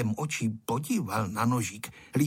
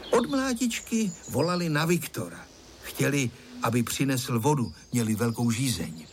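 plain reading voice

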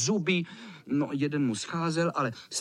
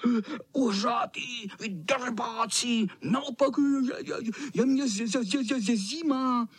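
high, whiny chattering cat voice; high freezing-cat stutter; shivering tomcat; 7 semitones higher than usual. Acted. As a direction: stammer and shiver with indignant cold. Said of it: "Drkotal zuby".

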